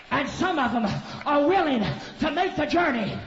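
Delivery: loud, high, ringing sermon shout; climbing Pentecostal shout over the music; full-force preaching. Acted. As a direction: preach it to the rafters.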